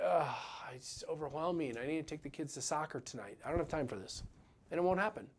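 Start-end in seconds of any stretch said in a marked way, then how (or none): 0.0-4.2 deflated and much softer, groaning it out; half-hearted overwhelmed grumble; mock overwhelmed. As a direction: sigh it like a swamped dentist with no time. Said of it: "you're gonna be like".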